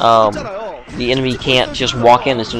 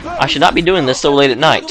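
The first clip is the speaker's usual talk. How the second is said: usual speaking voice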